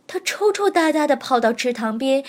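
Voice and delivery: ordinary delivery, nothing marked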